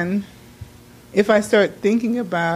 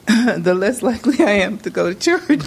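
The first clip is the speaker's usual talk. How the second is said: laughing; 3 semitones higher than usual. Real, not acted. Laughing at own reasoning.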